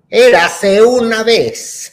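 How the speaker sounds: booming, high, very slow storybook opening; record storyteller. Retold or imitated. Imitating the fairy-tale record as it starts, grand storyteller. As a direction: stretch it out loud and grand like a narrator. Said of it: "así que empieza".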